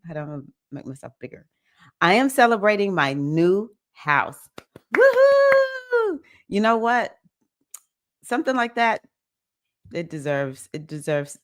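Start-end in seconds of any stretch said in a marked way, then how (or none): 5.0-6.2 high, bright whoops of celebration; excited cheering. Real, not acted. Host cheering a viewer's celebration, excited whoops. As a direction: cheer them on with big woohoos.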